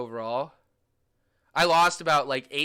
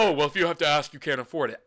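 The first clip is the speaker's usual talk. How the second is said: mock snooty reply; 5 dB louder, faster than usual. Mocking quote about asking the price.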